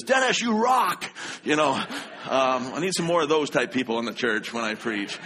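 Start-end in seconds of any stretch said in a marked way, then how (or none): 0.0-1.0 high, loud, slow cheer; excited shout. Retold or imitated. The young man cheers the pastor mid-sermon, high loud shout. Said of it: "he says".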